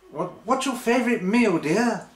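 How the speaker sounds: high-pitched put-on housewife voice; mock housewife in a lifted voice; lady of the house. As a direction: play an old-time wife, pitched high, asking dear husband. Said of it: "the lady of the house".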